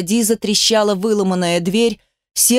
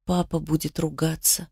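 soft, hushed little daughter; a low, anxious half-whisper; whispered child's line; 3 semitones lower, 8 dB quieter than usual. A narrator's reading. Daughter to mother in hiding, whispered. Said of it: "прошептала дочь".

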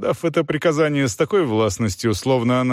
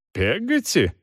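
indignant; 5 semitones higher, slower than usual. A narrator's reading. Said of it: "с некоторым негодованием повторила мисс Бетси".

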